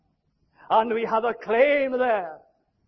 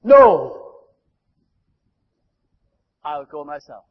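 God's resolve; 4 semitones lower, 8 dB louder, much slower than usual. Acted. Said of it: "Then he says".

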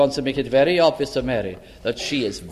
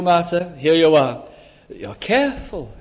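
normal talking voice

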